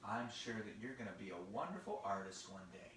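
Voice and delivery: low, soft reassurance; tender, quiet fatherly voice; encouraging father. Acted. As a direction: drop down low and gentle to encourage a child. Father encourages his daughter.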